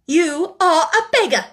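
loud, high, contemptuous accusation; temper rising. A narrator's reading. Headmistress insults the penniless orphan, temper rising. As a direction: fling it at the child. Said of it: "her temper rising".

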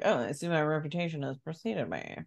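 quick, low, smug villain voice; lightly voiced smug creep, pleased with himself. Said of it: "he's like".

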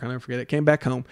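ordinary talk, nothing marked

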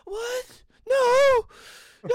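high loud fake wail; mock anguished shout. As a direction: wail no like heartbroken. Reacting to mock breakup.

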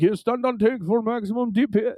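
taunting fake Russian accent; mocking Russian accent. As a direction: make fun of him with an exaggerated Russian accent. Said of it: "I'm just making fun of him".

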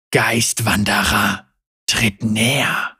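slow, low, menacing growl; drawn-out snarling summons; growling tiger spirit. A narrator's reading. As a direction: growl it out slowly like a beast. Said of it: "knurrte er".

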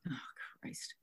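whispered, sighing exasperation; hushed groan under the breath; exasperated aside. Retold or imitated. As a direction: drop to a whisper in weary disbelief. Muttered exasperated reaction to friend.

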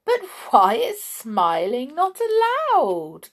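high, loud amused question; laughing question. A narrator's reading. Visitor questions the king's rule, laughing lightly. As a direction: ask it with a touch of laughter. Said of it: "laughed little Miss Sunshine".

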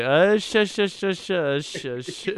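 urgent hushing, high then fading; frantic shushing. Retold or imitated. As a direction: hush her fast and hard. Retelling a man hushing a girl.

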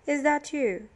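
accusing question from an irritated prisoner; suspicious challenge. A narrator's reading. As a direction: demand to know who it is. Annoyed prisoner challenges Billy in story.

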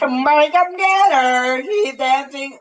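high, drawn-out wail; high-pitched comic singsong; silly put-on voice. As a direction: stretch it out slowly in a high silly voice. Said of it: "Oh, God, that voice".

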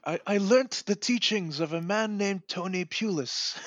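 high, slow, half-attempted German accent; botched German accent. Co-host mimics the coach in a bungled German accent. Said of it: "I can't do my German accent".

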